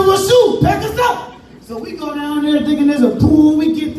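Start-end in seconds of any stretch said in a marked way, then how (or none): 0.0-1.3 put-on excited kid. Kid excited about pool.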